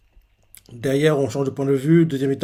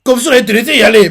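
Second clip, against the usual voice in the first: angry scolding; 7 semitones higher, 9 dB louder, faster than usual. Retold or imitated. Usopp scolds reckless Vegapunk.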